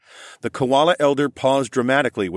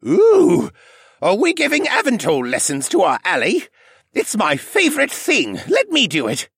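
put-on high, loud, giddy old koala; eager excitement; 13 semitones higher, 5 dB louder than usual. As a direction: bounce with eagerness. Jenner eager to teach.

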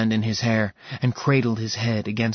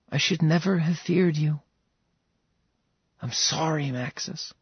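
higher, softer apology fading away; Serafina's voice, sorry; 5 semitones higher, 3 dB quieter than usual. Acted. Serafina apologizing to Max, soft and fading. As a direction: say sorry gently, letting it fall away.

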